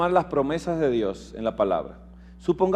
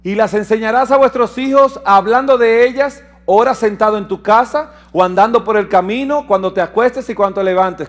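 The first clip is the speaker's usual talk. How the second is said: higher, louder ringing reading; proclaiming scripture; 4 semitones higher, 4 dB louder than usual. Acted. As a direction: lift the verse up to the hall. Reads scripture to congregation.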